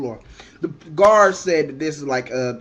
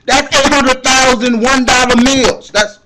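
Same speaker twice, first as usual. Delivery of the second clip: comic outraged rant; 8 semitones higher, 11 dB louder than usual. Retold or imitated. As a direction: shout it out in mock outrage. Ranting about bail cost.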